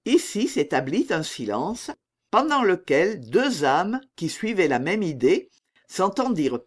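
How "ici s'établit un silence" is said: plain reading voice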